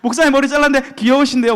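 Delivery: desired compliment. Shown as a demonstration. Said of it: "제가 원하는 답이 뭐예요?".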